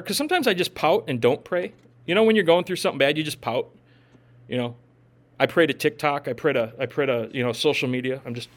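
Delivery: ordinary talk, nothing marked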